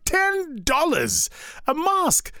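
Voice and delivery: high, slow disbelief; incredulous. Retold or imitated. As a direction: stretch it out in shock. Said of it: "I'm like".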